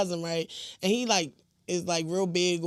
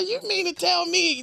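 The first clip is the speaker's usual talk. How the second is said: usual speaking voice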